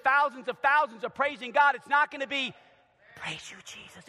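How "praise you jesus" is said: soft, limp "Praise you, Jesus"; meek quiet praise; timid praise. Shown as a demonstration. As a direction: mumble it half-heartedly. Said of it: "It's gonna be".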